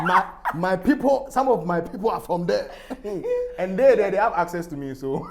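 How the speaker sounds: put-on laughing northern Ghanaian accent; northern Ghana accent, loud and high. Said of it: "the northern one as well".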